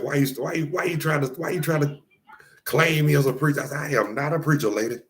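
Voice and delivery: high-pitched, retold argument; indignant protest. Retells arguing with insistent lady.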